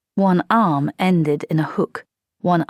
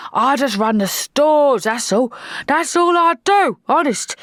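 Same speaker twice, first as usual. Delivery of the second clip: put-on high, louder protesting old corporal; anxious pleading; 9 semitones higher, 4 dB louder than usual. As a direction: plead your innocence nervously. Corporal pleading his innocence.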